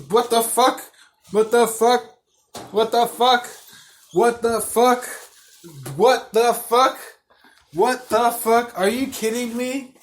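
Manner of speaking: high, incredulous repeated outburst; appalled, drawing out the words toward the end; outraged disbelief. Retold or imitated. Outraged replay of reaction to catfish.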